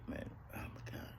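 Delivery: breathless hushed words; almost no voice, overcome. Real, not acted. Remembering a friend's death, hushed. Grief-struck memory, voice drops away.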